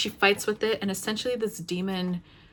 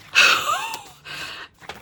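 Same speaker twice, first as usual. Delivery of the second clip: long overwhelmed sigh; 6 dB louder than usual. Real, not acted. Overwhelmed by the passage.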